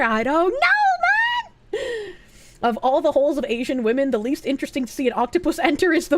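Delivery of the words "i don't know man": very high, stretched-out whine; hammed-up crying voice, long drawn out; mock despairing wail. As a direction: wail it in exaggerated helplessness. Mock despair at soup question.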